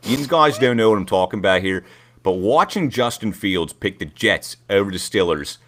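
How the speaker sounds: put-on loud Pittsburgh Yinzer accent, ranting fan; Yinzer Bob. Host doing Yinzer Bob for cohosts, loud Pittsburgh accent rant. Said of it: "Yinzer Bob".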